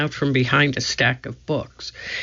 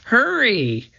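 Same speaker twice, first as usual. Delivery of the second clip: calling out; 8 semitones higher than usual. A narrator's reading. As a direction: hurry him along from afar. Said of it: "called Annie".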